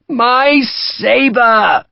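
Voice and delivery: high, loud drawn-out plea; pleading. A narrator's reading. The Brigadier pleads for his sabre, high loud old man. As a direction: beg for the sabre. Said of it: "the Brigadier pleaded".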